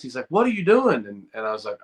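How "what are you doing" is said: sharp high yell at a player; mock coach yelling "What are you doing?"; retold coach yelling. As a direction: shout it like an angry coach. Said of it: "my coach yelled and cussed".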